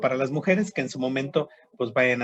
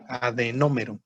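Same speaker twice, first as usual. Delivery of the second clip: adenómero, lower, landing on NÓ; stress on first O; 4 semitones lower than usual. Shown as a demonstration. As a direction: model adenómero for students, accent on the first o. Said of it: "y con acento en la O".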